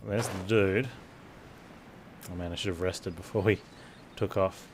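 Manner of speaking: ordinary talk, nothing marked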